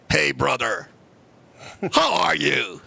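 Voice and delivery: low, slow, booming kung fu master voice; deep, drawn-out mock Shaolin greeting; mock kung fu monk. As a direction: play a solemn Shaolin monk from a martial arts film. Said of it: "I was like".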